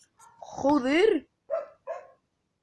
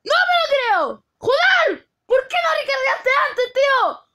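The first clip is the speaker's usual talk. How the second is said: high, very loud raging yell; furious shouting; 14 semitones higher, 9 dB louder, slower than usual. Real, not acted. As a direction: scream in disbelief and fury. Raging after losing the match.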